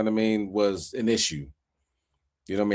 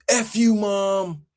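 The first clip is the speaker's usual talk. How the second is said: mock teen cussing out mom; 10 semitones higher, slower than usual. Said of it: "that's how you be like".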